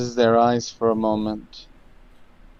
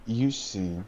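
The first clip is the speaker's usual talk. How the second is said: ominous narration; 3 semitones lower, 8 dB quieter than usual. A narrator's reading.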